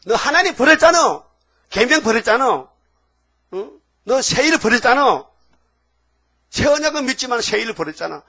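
high, loud scolding accusation; accusing rebuke. Acted. God charging Korean churches with abandonment, loud and high. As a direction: thunder the charge at them.

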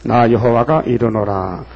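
slow, falling solemn recitation; scripture reading. Acted. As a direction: intone the verse gravely. Quotes the Lord in scripture. (느리고 엄숙한 성경 낭독)

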